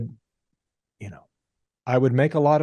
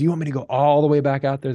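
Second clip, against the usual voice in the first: higher-pitched, quick plea of disbelief; incredulous protest; 4 semitones higher, faster than usual. Retold or imitated. Tired kid pleading with ice seller, incredulous. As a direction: protest like a tired worker sent away.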